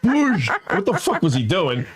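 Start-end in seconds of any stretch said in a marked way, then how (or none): 0.1-0.5 a quick whoosh as if someone gets knocked over; whoosh sound. Retold or imitated. Friend mimics Gino bumping him down with a whoosh. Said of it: "Gino was like".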